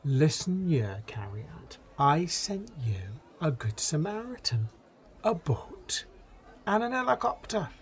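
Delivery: lilting, exasperated Welsh God; hammed-up Welsh telling-off; Welsh accent. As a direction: count it off with a sing-song Welsh lilt. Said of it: "God's obviously Welsh".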